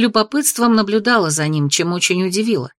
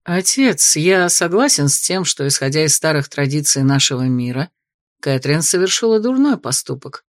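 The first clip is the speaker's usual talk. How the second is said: elder son interjecting; 5 semitones lower than usual. Acted. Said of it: "вмешался Артур".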